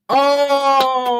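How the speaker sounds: long, loud high-pitched "oh!"; goal shout. Real, not acted. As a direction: cry out as the goal goes in.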